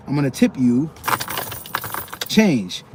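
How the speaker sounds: usual speaking voice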